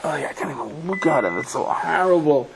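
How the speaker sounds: mock horror, slowing down; acted revulsion. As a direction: recoil from a horrible sight, drawing out the last words. Acting out revulsion at the man.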